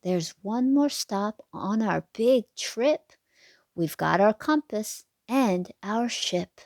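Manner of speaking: put-on lilting sing-song read to little kids; bouncy storybook rhyme voice; reading to children. A narrator's reading. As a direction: read the rhyme like a bedtime picture book. Rhyming picture book read aloud.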